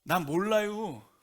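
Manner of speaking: slow, loud regional drawl; slow Galilean drawl. Shown as a demonstration. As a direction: stretch it out in a slow country dialect. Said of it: "현지어로 이렇게 딱 해보면".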